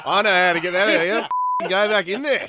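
loud, high mock order; hammed-up military tough love; Hammond. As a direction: bellow it like an old-school commander. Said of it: "according to Hammond, the best treatment".

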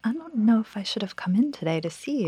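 hushed whisper, barely voiced. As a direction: whisper it like you're afraid to catch it. Joking about catching the sickness.

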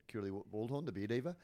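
ordinary talk

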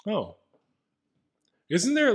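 ordinary talk

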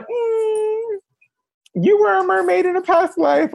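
high, whiny mocking hum then sarcastic line; mock disbelief. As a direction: play the skeptic, teasing hard. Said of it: "I'm like".

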